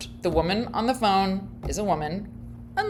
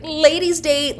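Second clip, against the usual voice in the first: higher, louder ladies' date; caller quote; 10 semitones higher, 6 dB louder than usual. Retold or imitated. Quoting the caller's next line, higher and louder. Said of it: "it's like".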